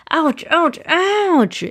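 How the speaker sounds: high yelps of pain, building; pained exclamation. A narrator's reading. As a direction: cry out as if stung. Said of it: "he exclaimed as he sat down".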